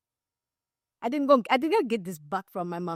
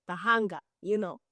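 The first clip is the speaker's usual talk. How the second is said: ordinary talk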